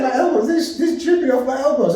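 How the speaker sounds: high, laughing, appalled; laughing panic. Retold or imitated. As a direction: squeal it through laughter in dismay. Retold sweating panic, laughing.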